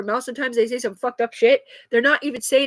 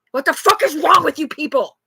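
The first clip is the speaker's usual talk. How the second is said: louder, exasperated shout; angry outburst; 4 semitones higher, 5 dB louder than usual. Real, not acted. Ranting at the people accusing her, angry outburst. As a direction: throw it at them, fed up.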